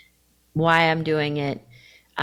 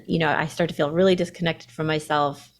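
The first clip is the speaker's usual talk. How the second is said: normal talking voice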